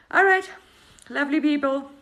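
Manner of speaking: rally the viewers, big and cheerful; host calling out to her audience; loud cheery call. Real, not acted.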